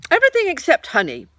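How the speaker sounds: high, loud bouncy tiger voice; big bouncy tiger; Tigger voice. A narrator's reading. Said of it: "said Tigger".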